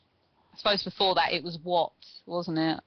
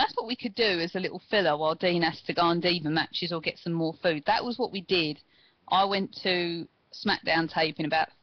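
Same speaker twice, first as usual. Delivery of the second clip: normal talking voice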